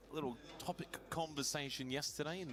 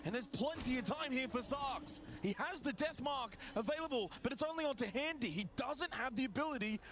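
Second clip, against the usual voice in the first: excited; 7 semitones higher, 6 dB louder than usual. Real, not acted. Clutch one v one play-by-play.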